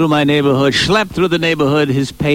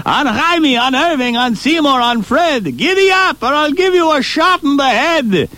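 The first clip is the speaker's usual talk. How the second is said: calling out; 10 semitones higher than usual. Acted. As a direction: holler the names, urging them on. Calling out to the reindeer.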